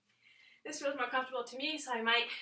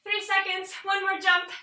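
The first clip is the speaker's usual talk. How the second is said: calling out; 6 semitones higher, 6 dB louder than usual. Real, not acted. Coach calling out final countdown.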